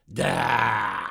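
loud, gloating cry; screeching. A narrator's reading. Old woman cheering from the mirror, screeching. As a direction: crow it out loudly. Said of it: "завизжала из зеркала старуха".

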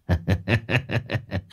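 soft cruel laugh; quiet sneering chuckle. Acted. Boss sneers.

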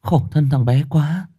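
high-pitched tearful lament; weepy, pitying woman's voice; sniffling. Acted. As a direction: whimper with pity for mother and baby. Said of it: "rồi thút thít".

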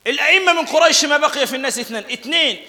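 high, loud, ringing hadith recital; preaching at full force. Acted. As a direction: proclaim it like a sermon peak.

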